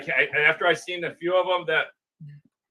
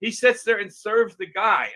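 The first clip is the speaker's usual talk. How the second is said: higher, louder, slow retelling of the old friend, lightly voiced; imitating his friend; 6 semitones higher, 6 dB louder, slower than usual. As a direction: lightly play the upset father. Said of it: "but he says".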